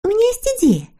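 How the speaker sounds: put-on very high, sweet girlish character voice; eager little girl figure. A narrator's reading. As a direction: agree gladly in a high little voice. Snork Maiden proposes an idea. (тоненький девичий голосок)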